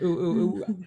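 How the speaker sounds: imitating his high oohs; hammed-up ooh ooh; mock oohing. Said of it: "he was like".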